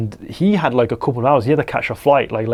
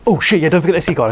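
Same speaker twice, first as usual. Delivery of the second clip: quick, higher-pitched mock alarm; sudden remembering; 5 semitones higher, 3 dB louder, faster than usual. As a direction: rush it out as if just reminded. Acts out reading a reminder note.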